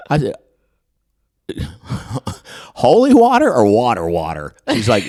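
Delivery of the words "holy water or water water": loud, higher mock disbelief; loudly baffled; incredulous question. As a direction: burst out with the retold question. Retold incredulous question to her.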